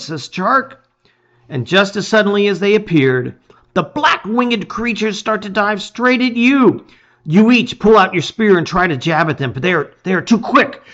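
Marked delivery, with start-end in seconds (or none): none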